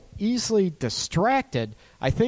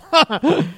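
laugh; 11 semitones higher, 6 dB louder than usual. Real, not acted. Laugh after acted reaction.

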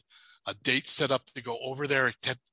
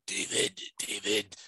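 breathy moan, pitched lower; ghostly moaning; 4 semitones lower, 4 dB quieter, slower than usual. Retold or imitated. The voice on the phone moaning Dave's name, low breathy moan. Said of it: "now it's moaning my name".

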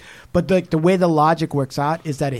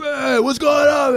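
high, drawn-out mock shout; brash alter ego; 10 semitones higher, slower than usual. [name] comes out under hypnosis, mock-rowdy shout. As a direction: burst out as the rowdy other personality.

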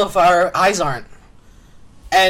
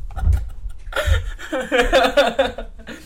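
long real burst of higher laughter; 5 semitones higher than usual. Real, not acted. Host cracks up over the silly game answer, laughing. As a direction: laugh helplessly for several seconds.

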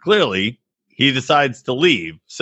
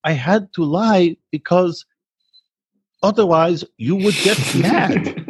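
Asuelu impression; 5 semitones higher than usual. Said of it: "He's like".

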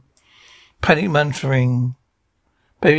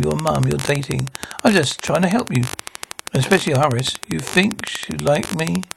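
ordinary talk

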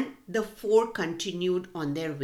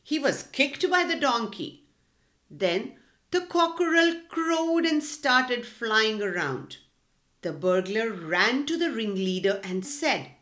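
ordinary delivery, nothing marked

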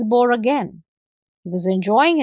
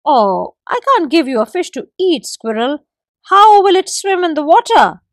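higher, louder mock refusal; gecko refusing; 6 semitones higher, 5 dB louder than usual. A narrator's reading. Gecko refuses the squirrel, higher and louder. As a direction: turn him down innocently. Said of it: "refused the forest gecko".

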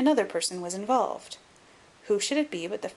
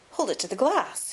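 raised, higher exclamation; excited cry; 5 semitones higher, 3 dB louder than usual. A narrator's reading. Excited Cyril Overton urging them to check the glass, crying out. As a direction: call it out eagerly. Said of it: "he cried".

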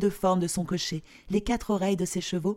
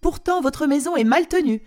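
higher, loud nagging lecture; scolding queen; 4 semitones higher, 5 dB louder than usual. Acted. Queen lists everything wrong with their cabin, loud and high. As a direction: rattle off the complaints grandly. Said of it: "dit-elle".

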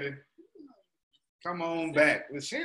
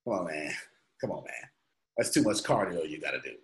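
1 self-doubting voice; 8 semitones lower, 6 dB quieter, faster than usual. Retold or imitated. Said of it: "And I go".

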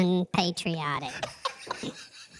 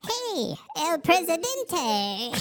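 high creature voice; 9 semitones higher, slower than usual. Acted. Mock greeting from a creature.

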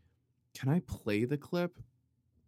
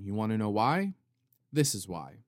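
ordinary talk

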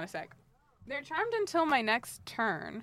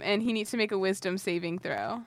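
normal talking voice

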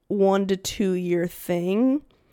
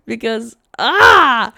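frustrated cry; 4 semitones higher, 11 dB louder, much slower than usual. Real, not acted. Frustrated exclamation.

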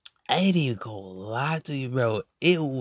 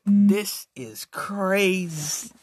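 slow, stretched-out disbelief; reacting to the live, slow stunned drawl; drawn out; 5 semitones higher, much slower than usual. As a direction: drag each word in amazement. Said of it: "And I was like".

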